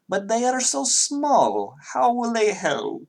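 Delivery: put-on high, slow Russian accent, doubtful; Natalia's high, slow Russian doubt; Russian girl. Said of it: "Natalia says".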